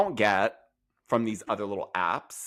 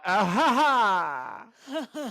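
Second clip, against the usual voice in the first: imitated high laugh; hammed-up laugh climbing in pitch; mock laugh; 11 semitones higher, 3 dB louder, much slower than usual. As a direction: put on a big, high fake laugh. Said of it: "And she goes".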